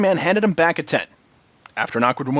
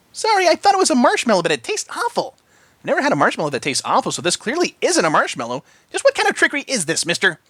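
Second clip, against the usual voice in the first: high, fast indignant pony voice; demanding Pinky; 9 semitones higher than usual. A narrator's reading. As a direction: accuse him with pony logic. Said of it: "Pinky demanded".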